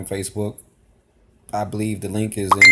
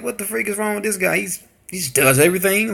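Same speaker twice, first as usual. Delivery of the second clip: higher-pitched mimicry of baffled complainers; mock indignant critic; 8 semitones higher than usual. Imagined viewers grumble about the guy who pauses videos, mock indignant. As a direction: imitate a puzzled complaint in a raised pitch.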